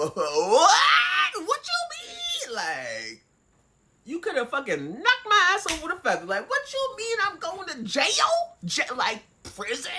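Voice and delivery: high, loud disbelieving cry; flipping out. Retold or imitated. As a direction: shriek in shocked disbelief, near tears. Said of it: "when I'm, like, flipping out".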